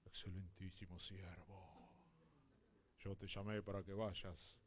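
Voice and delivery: low, soft, solemn mock God; grand divine voice. Pastor mocks the grand call people expect from God, low and solemn. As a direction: intone it like a pompous heavenly decree. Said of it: "No creas que Dios te va a decir".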